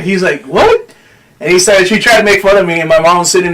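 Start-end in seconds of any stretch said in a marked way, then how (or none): 0.4-0.9 high incredulous one-word question; mock-astonished yelp of a question; retold surprise. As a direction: imitate a friend caught off guard, pitch shooting up. Friend reacts to his confession.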